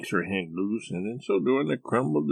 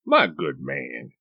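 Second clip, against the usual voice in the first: slow, higher-pitched stuffy British gentleman; stuffy British accent; 8 semitones higher, slower than usual. A narrator's reading. Diner addresses the server in all gravity, stuffy British accent. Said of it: "in his best stuffy British accent".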